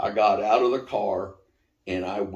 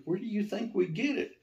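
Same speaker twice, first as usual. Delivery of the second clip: lightly voiced office lady, pitched up; puzzled high question; 11 semitones higher, 6 dB quieter than usual. Office lady asks David where holy water comes from, puzzled and high.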